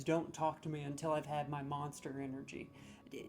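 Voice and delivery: normal talking voice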